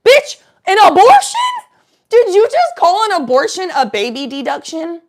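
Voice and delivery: high, loud shout of disbelief; hammed-up scandalized yell; incredulous outburst. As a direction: explode in exaggerated shock. Teller's outraged reaction to story.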